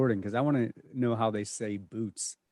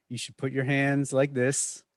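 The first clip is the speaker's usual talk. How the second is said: normal talking voice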